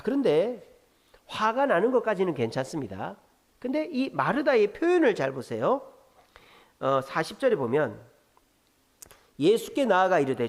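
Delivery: ordinary talk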